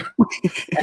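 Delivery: short breathy laugh. Real, not acted. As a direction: burst out in a quick laugh. Laughs after mock warning exchange.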